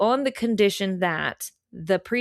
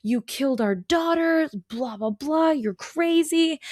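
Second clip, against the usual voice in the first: freaking out; 8 semitones higher than usual. Acted. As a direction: wail it higher as hammed-up horrified parents. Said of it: "they're freaking out".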